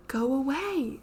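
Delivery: high terrified shriek; scream. A narrator's reading. Ghostly child begging to be left alone, screaming. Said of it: "it screamed".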